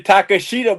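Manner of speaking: mock the long tah-kah-SHEE-ta in "Takashita"; goofy-sounding pronunciation. Teasing the co-host's way, goofy full-vowel pronunciation. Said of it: "it sounds goofier when you say".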